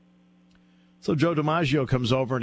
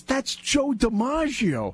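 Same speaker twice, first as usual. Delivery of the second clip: Trey's correction; 10 semitones higher, much slower than usual. Retold or imitated. Trey corrects him slowly.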